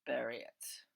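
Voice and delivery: low, cold command; low, disgusted order. Acted. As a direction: spit it out with disgust. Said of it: "voice dripped with disgust".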